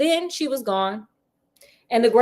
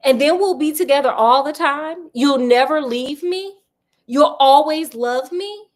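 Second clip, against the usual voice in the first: higher, louder desperate pleading; 5 semitones higher, 5 dB louder than usual. A narrator's reading. Heather begs the ghost to stay forever, desperately. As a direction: plead for reassurance, pitch lifted. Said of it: "desperation in her voice and gestures".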